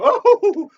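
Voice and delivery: high, loud mock "oh"; imitated squeal. Imitating a stage girl being pinched, high squeal. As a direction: yelp as if pinched. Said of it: "and she would go".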